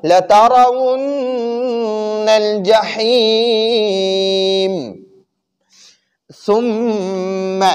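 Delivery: slow, drawn-out tajweed chanting; Quran recitation. Shown as a demonstration. Teacher recites verse for students, slow chant. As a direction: recite it melodically for the class.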